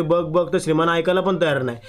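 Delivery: usual speaking voice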